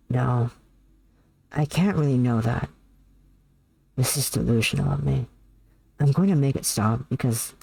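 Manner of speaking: low, measured message reading; confessional reading. Acted. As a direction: read a private confession low and deliberately. Reads an anguished message aloud.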